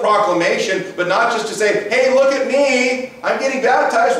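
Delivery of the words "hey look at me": ordinary delivery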